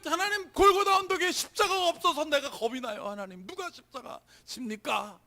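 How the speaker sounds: loud, high outcry easing, then surging back; re-enacted lamenting prayer. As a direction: plead with God in a retold anguished prayer. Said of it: "막 탄식하면서 막 그냥 소리 지르면서".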